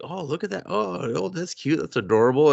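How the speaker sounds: high, mock-sweet cooing; cooing woman. Heroine cooing over Larson, high and mock-sweet. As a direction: coo at it like at a puppy.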